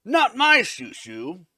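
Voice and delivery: groan, then protest loudly and slowly; hammed-up outraged hero; mock mad. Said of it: "he gets a little mad".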